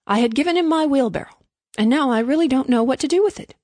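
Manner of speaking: put-upon exasperation; aggrieved complaint. A narrator's reading. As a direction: complain as if you were the one wronged. Miller complains about his own loss.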